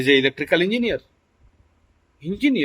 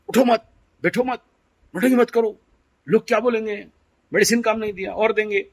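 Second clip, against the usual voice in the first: higher-pitched mock scolding in a run of short commands; imitated fretful scolding; 6 semitones higher than usual. Spouse restraining the patient on the bus, imitated scolding. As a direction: lightly act out a worried spouse telling someone off.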